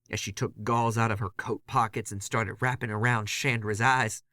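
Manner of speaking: ordinary delivery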